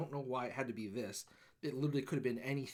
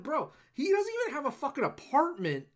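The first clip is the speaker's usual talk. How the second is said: exasperated retort; 11 semitones higher, 5 dB louder than usual. Retold or imitated. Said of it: "I'm like".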